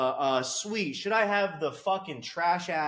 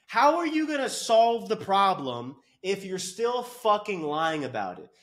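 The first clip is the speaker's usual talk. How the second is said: ordinary delivery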